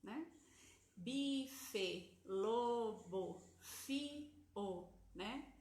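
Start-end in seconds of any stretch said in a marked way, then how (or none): 0.9-4.9 each word a bit louder, carefully spelled out; louder example words. Shown as a demonstration. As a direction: model "Bife, lobo, fio" one by one for a child. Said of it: "Olha quantas palavras simples".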